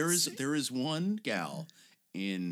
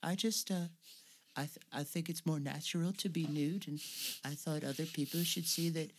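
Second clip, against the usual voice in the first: gentle mock interview voice; imitating the actress; 5 semitones higher than usual. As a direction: mimic a shy earnest starlet. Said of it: "an interview with her and she's like".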